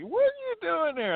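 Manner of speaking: very high Tennessee local voice; Tennessee accent. Retold or imitated. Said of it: "I'm trying to do my best Tennessee accent".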